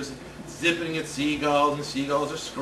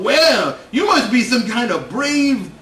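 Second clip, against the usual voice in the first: put-on much louder, higher, drawn-out old man yell; loud cranky old man; 4 semitones higher, 8 dB louder, slower than usual. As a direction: holler it like a grumpy old fisherman. Old guy yelling at kid.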